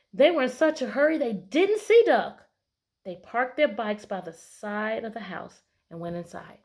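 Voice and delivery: excited picture book narration; dramatic narration. A narrator's reading. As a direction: build the surprise for the kids. Reads picture book aloud.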